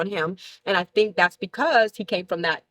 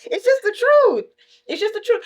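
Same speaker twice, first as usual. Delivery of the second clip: laughing insistence; 14 semitones higher, 4 dB louder than usual. Real, not acted. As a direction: insist through a high laugh. Laughing insistence, much higher.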